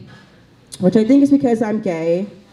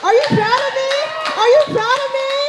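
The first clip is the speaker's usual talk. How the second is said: mock-needy squeal, much higher; high-pitched pleading; faster than usual. Comic begging the crowd for approval, high-pitched pleading.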